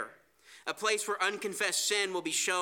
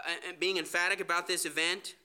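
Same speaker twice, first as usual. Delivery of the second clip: ordinary talk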